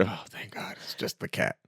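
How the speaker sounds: whispered sigh of relief; relieved whisper. Retold or imitated. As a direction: breathe it out in a whisper. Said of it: "it's like".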